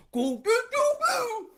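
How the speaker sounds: high hoots in place of words; mock deaf holler; imitated deaf man. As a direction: mimic blurred deaf speech, rising in volume. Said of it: "I'm trying to interpret this shit".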